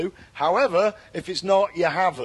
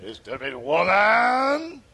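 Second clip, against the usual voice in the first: catchphrase voice; slower than usual. Retold or imitated. Quoting Allo Allo catchphrase.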